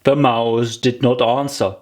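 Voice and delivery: usual speaking voice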